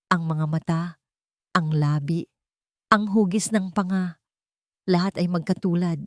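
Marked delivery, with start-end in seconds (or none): none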